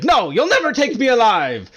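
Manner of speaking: high, loud, hammed-up heroic defiance; mock defiant cry. Player mocking a dramatic stand to the shopkeeper, hammed-up loud cry. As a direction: fling it back like a cornered hero. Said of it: "She's like".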